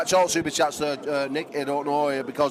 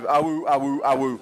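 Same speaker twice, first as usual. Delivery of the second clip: wolf howl; 4 semitones lower than usual. Pretend.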